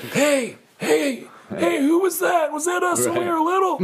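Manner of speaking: put-on very high, slow old codger; hammed-up high-pitched elder; old-timer listening back. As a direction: call out in a high, befuddled old voice. Old selves hearing their young voices.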